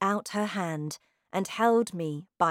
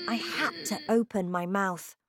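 ordinary delivery, nothing marked

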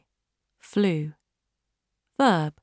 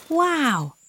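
amazed wow; 10 semitones higher than usual. A narrator's reading. Billy sees birds fly off.

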